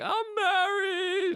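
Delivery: high, drawn-out fake wail; hammed-up sobbing confession; mock crying voice. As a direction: wail it slowly in mock tears. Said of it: "in a crying voice".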